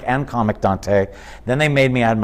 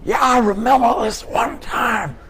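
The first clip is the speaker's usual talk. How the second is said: put-on higher, shouted old Pryor voice; older Pryor impression; 11 semitones higher than usual. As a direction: play the elder Pryor telling a story. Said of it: "It was more like".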